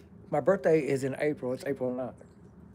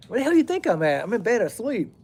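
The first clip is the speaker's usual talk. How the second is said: higher, sharp mock irritation; irritated retort; 6 semitones higher than usual. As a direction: snap back at the late caller. Annoyed answer to late call.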